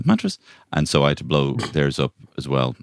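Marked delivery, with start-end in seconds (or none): 0.1-0.4 puzzled echo. Pretend.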